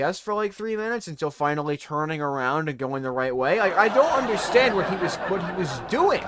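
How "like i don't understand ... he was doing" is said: high, loud disbelief; baffled exasperation. Real, not acted. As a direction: vent in total bewilderment. Baffled venting about the driver.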